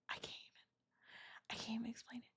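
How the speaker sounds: whispered through a sigh; very soft, breath nearly gone; too moved to get the words out; overcome, barely audible. Real, not acted. Overcome, sighing while telling the story.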